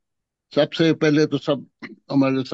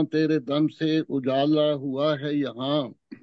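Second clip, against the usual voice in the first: measured, solemn poetry recital; stately nazm recitation. Acted. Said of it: "ایک نظم پیش کروں گا".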